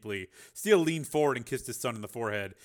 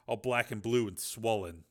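ordinary delivery

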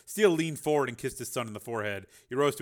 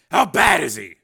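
worried father; 6 semitones higher, 15 dB louder than usual. A narrator's reading.

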